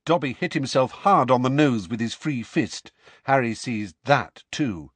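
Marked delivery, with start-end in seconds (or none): none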